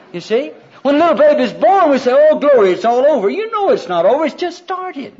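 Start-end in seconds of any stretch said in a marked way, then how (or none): 2.1-3.3 high, loud mock exultation; mock elation. Preacher voicing people when a baby is born, mock elation. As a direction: exclaim like an overjoyed new parent. Parents at a birth, voiced.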